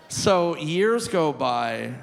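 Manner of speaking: ordinary delivery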